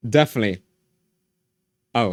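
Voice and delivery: normal talking voice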